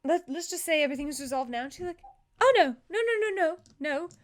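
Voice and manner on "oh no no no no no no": high, loud "oh no" into rapid noes; acted high-pitched protest; alarmed refusal. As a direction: cut off the excuse firmly. Jin refuses evasion.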